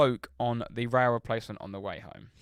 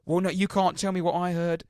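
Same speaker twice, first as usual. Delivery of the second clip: higher, louder mock huff; indignant passenger; 7 semitones higher, 5 dB louder than usual. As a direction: insist like a stubborn drunk. Drunk passenger insisting.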